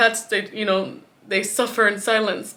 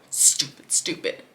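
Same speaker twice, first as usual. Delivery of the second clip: voiceless, bitter whisper; self-scolding whisper; 6 semitones lower, 3 dB quieter, slower than usual. Real, not acted. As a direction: hiss the word at yourself. Berating herself.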